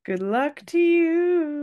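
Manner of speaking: hammed-up singsong blessing; mock dramatic farewell. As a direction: stretch it like a theatrical send-off. Mock sing-song repeat.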